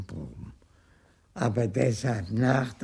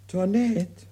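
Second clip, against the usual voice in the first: raised, higher call for the maid; 8 semitones higher than usual. Acted. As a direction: call Toinette across the house. Argan calling his maid.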